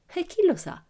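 high-pitched "who knows"; airy, high-pitched reply as Pippi; answering question. A narrator's reading. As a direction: toss it back in a high voice. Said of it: "rispose Pippi".

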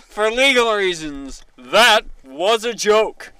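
slow, loud, deliberate announcement; loud formal disclaimer. Shown as a demonstration. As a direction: proclaim it like an official statement. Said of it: "state it".

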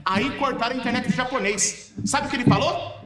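high clown telling a joke. Acted. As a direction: set up the pun like a circus clown. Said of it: "Como Palhaço Amendoim".

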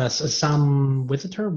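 usual speaking voice

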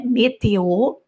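"Météo" with both é closed and clear; French pronunciation. Shown as a demonstration. A teacher showing how the accented e sounds in "Météo". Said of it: "tem dois acentos aqui".

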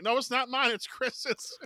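high, loud counter-protest; mock denial. Friend passes blame back, high mock protest. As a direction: push the blame back, acted.

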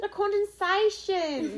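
slow, high, drawn-out dramatic delivery; hammed-up dramatic line. Hamming it up for her co-host, high and slow. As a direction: stretch it out like a theatrical reveal.

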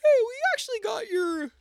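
high put-on family voice; squeaky high family impression; entire family in one voice. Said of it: "this is me doing your entire family".

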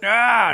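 high drawn-out comic yell; mock cry of pain. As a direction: cry out as if hit, hammed up. Acted cry when hit.

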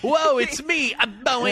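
high, goofy radio host intro; boing-noise host. Acted. Radio host showing off boing sound effects, high and zany. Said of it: "a lot of boing noises".

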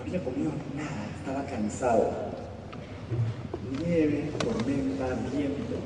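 soft, low storyteller voice drawing listeners in; hushed story narration. A narrator's reading. Telling the audience the wolf's story, hushed. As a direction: tell the fable gently and quietly. Quiet storytelling of the wolf fable.

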